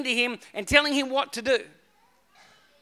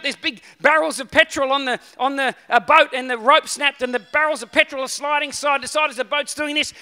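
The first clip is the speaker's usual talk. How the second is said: ordinary talk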